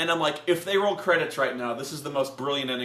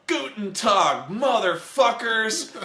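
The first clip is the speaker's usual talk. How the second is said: slow, loud, raised action one-liner; heroine's greeting; 6 semitones higher, 6 dB louder, much slower than usual. Retold or imitated. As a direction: savour the mock tough-heroine greeting. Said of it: "she goes".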